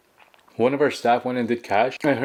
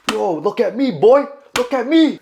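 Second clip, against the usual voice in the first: high-pitched, loud hammed-up confrontation; joking mock shout; 11 semitones higher, 6 dB louder than usual. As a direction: play-act yelling at a man to face you. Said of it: "No, kid, I didn't say that".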